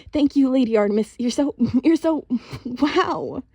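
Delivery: high, loud, breathless gushing with a laugh; excited yammering. A narrator's reading. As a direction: gush over the goddess in awe. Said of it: "started yammering".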